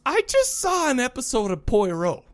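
imitating grandma. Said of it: "she goes".

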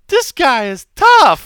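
put-on high, slow little kid voice; kid's voice. Dad imitating his son losing to Mark Hunt, high and slow. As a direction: mimic his five-year-old son, high and drawn out. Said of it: "He's like".

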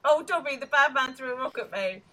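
high, loud mock-childish plea; mocking whine. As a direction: put on a little tattletale's voice to taunt. Mocking a tattletale plea to Dobby.

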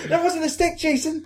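very high indignant character voice; acted-out high complaint; high-pitched protest. Acting out vampires' jeer at werewolves.

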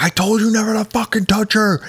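high furious yell; retold jealous fury; ballistic rage. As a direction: explode at him, out of control. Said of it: "he goes ballistic".

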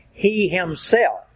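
slow, "He" lifted and weighted; stress on He. Shown as a demonstration. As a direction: hit "He" hard, then "himself". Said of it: "there is a double emphasis on that word".

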